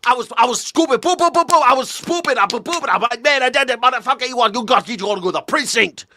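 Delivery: loud, high, manic New York mimicry; mock an over-excited New Yorker at full pitch; hammed-up New York yelling, stumbling over the words; New York accent parody. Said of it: "But in the city".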